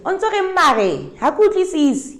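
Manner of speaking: high, loud, fast mock exasperation; exasperated protest. Daughter protesting to the men about her dad, high exasperated squeal. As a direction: squeal it like a flustered girl who won't understand. Protesting to dead dad's messengers.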